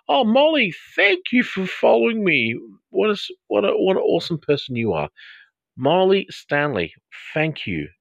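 high-pitched delighted squeal of gratitude; real, over-the-top excited thank-you; gushing thanks. Real, not acted. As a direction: gush excitedly, voice way up, then easing down. Gushing thanks to a new follower.

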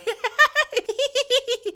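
very high mock giggle; little girl laughing. Ghost girl laughing near the guard, high giggle. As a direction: squeal out an imitation child's giggle. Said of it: "this little girl laughing like".

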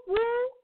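high loud whoop cry. Shown as a demonstration. Host calling the radio audience to praise, loud high whoop. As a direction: let out a big high celebratory whoop. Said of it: "give me a whoop".

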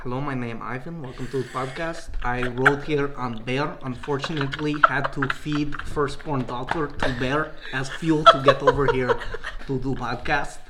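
put-on lightly done comic Russian accent with broken English; a mild Russian guest introducing himself. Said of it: "funny Russian impression".

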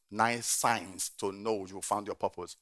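slow, each word weighed; slow emphatic repeat. Shown as a demonstration. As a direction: spell it out slowly. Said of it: "slow repeat".

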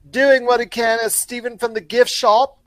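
loud, high, badly faked British accent; horrible British accent. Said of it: "that's actually a horrible accent".

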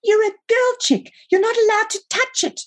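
put-on very high, squeaky boy's voice; piping small-boy objection; bratty little brother, protesting. A narrator's reading. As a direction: squeal it like an indignant kid brother.

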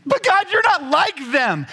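high, slow mock complaining voice; hammed-up childish objection; whiny protest. Believer objecting to God, hammed-up whine. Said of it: "protest to God".

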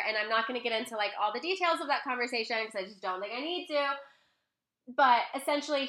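normal talking voice

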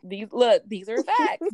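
pumped-up insistence; excited, emphatic. Real, not acted. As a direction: declare it like a fan cheering. Excited agreement with co-host.

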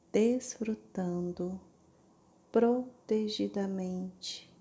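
slow, syllable by syllable; over enunciating. Shown as a demonstration. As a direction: stretch every syllable out slowly. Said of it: "lentamente, sílaba por sílaba". (pronunciando sílaba por sílaba)

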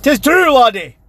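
loud, high Scottish brogue; Scottish accent. Acted. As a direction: boom it like an old Scot. Sudden Scottish quip.

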